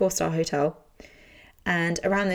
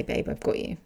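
tender whisper; 4 dB quieter than usual. Retold or imitated. Imagined comforting words.

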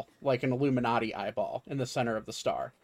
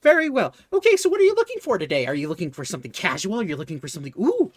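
very high, fast, gushing sales patter; excited shopkeeper; 5 semitones higher, 5 dB louder, much faster than usual. Acted. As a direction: rattle off the options in a rush of delight. Shopkeeper rushes into her sales pitch.

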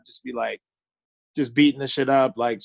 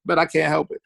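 normal talking voice

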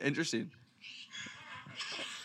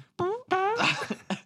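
fake throat clear. Shown as a demonstration. Demoing covering a fart.

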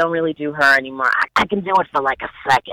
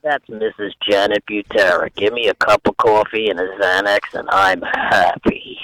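imitating mom; 7 semitones lower than usual. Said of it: "I've done my mother before".

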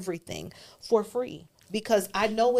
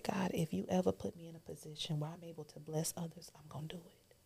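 whispered, fading almost to nothing; hushed vow; 11 dB quieter than usual. Real, not acted. Guest recounting her vow to God in the car, whispered. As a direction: breathe the promise like a secret prayer.

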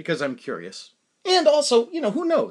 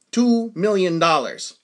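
"Two million dollars" slow and louder, each word weighted; emphatic price demand; 3 semitones higher, 5 dB louder, slower than usual. Retold or imitated. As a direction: state "Two million dollars" slowly and firmly. Repeats absurd price to buyer.

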